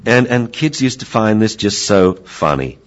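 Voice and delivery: normal talking voice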